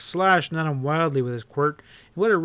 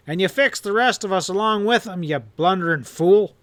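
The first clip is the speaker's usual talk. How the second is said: roared out, higher and louder; roaring; 5 semitones higher, 5 dB louder than usual. Acted. Outlaw boss roaring at his bungling partner, furious. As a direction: bellow at the blunderer. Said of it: "Hanlon roared".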